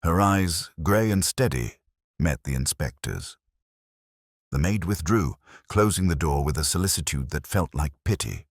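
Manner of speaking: usual speaking voice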